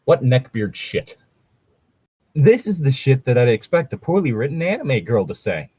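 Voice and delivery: ordinary talk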